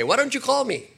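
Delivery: high complaining mother's voice; mock nagging mother; imitating a mother. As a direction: whine it like a nagging mom on the phone. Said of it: "she would get on the phone and say".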